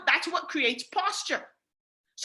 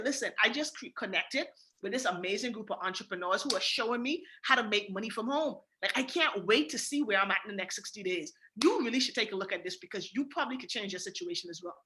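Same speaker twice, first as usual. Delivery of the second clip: lightly voiced confident, a touch lower and softer; confident pitch; 5 semitones lower, 5 dB quieter than usual. Shown as a demonstration. Pitching a prospect, calm and confident. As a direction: sell it like you believe it. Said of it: "make sure that you're confident".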